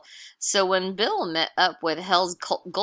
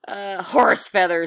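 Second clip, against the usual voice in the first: gruff cowboy; 2 semitones higher, 9 dB louder than usual. Acted. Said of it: "said Chuck".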